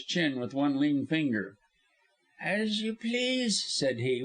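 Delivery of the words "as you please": slow, high, offhand reply; airy, drawn-out indifference; feigned carelessness. A narrator's reading. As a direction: shrug it off with drawn-out words. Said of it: "with apparent carelessness".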